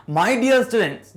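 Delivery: fond address. Real, not acted. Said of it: "addressing students".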